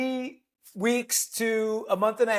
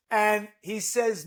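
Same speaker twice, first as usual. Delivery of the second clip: ordinary talk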